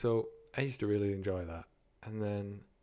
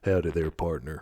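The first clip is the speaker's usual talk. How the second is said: put-on Texas woman accent; 4 semitones lower than usual. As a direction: lower put-on Texan woman. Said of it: "I can do like a female from Texas".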